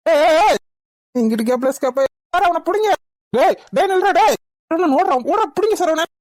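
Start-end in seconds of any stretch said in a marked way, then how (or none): 0.1-0.6 higher, louder calls of hey; shouting after him. Acted. Hero shouts at the fleeing kidnapper to stop. 2.3-6.1 higher urgent calls to grab him; calling out. Acted. Hero yells to the police as the kidnapper runs.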